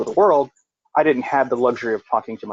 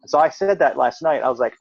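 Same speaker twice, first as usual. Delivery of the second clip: ordinary talk, nothing marked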